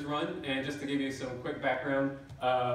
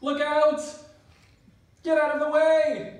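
high, loud panicked yelling; panicked shout; 13 semitones higher, 10 dB louder than usual. Acted. Driver yelling at people ahead of the speeding van, panicked shout. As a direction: scream a warning at the road. Careening van about to crash.

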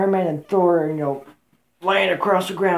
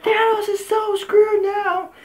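squealing mock reaction, much higher; excited outburst; much slower than usual. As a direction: yelp it like in the theater seat. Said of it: "I was like".

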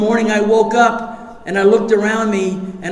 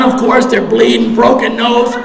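usual speaking voice

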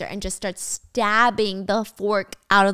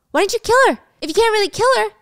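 retold shout; 11 semitones higher, 5 dB louder, much faster than usual. Danny confronting Wendy with knife.

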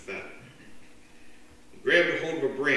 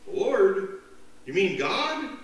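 astonished; 8 semitones higher, 5 dB louder, slower than usual. Acted. Man surprised.

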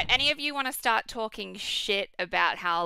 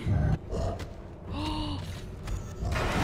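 scared gasp; 7 semitones higher, 7 dB quieter than usual. Real, not acted. Monster appears.

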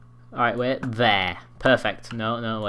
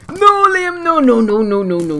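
very high loud scream sliding steadily down; descending siren of dismay; panicked wail; 12 semitones higher, 9 dB louder than usual. Real, not acted. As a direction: shriek no, letting pitch and volume fall. Panicking as opponent attacks.

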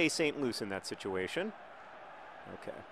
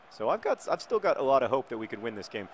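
usual speaking voice

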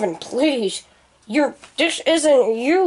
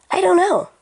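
normal talking voice

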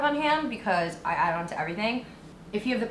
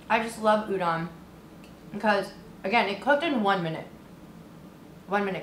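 eating between words; talking while chewing; faster than usual. Real, not acted. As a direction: mouth partly full. Seen 2: took a bite at -3; lips pursed, mouth working while talking.

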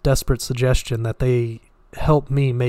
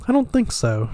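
innocently; 10 semitones higher than usual. A narrator's reading. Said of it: "she said innocently".

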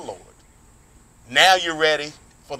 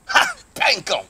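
triumphant shout. Real, not acted. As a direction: bark out the win.

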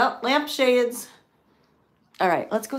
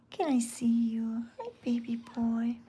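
whispered to a cat; 4 dB quieter than usual. Real, not acted. Woman whispering softly to a shy cat. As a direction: breathe the words gently to the kitten.

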